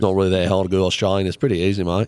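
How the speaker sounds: quick, loud mock Australian; Australian accent. Shown as a demonstration. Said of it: "Mine always goes Australian".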